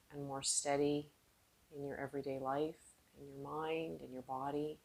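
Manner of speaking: calm, unhurried meditation voice with long pauses; guided meditation. Real, not acted. Yoga teacher guiding seated students inward, calm guided meditation. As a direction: ease them inward, letting phrases trail off softly.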